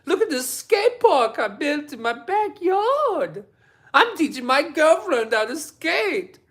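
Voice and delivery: mocking imitation. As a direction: sneer at him in a whiny vlogger voice. Mocking Jason Park's vlog.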